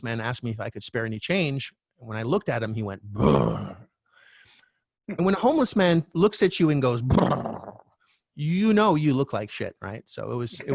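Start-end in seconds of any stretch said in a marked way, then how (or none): 3.0-3.8 shivering brr. Retold or imitated. Homeless man shivering at him. 7.0-7.7 shivering brr. Retold or imitated. Homeless man's brr retold.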